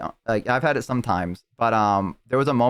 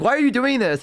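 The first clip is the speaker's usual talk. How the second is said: much higher, upset questioning; 12 semitones higher than usual. Retold or imitated. Tiefling asking her friend why he keeps climbing, high upset. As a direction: ask why in a tight, upset voice. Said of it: "She's like".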